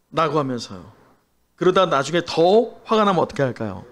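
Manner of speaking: usual speaking voice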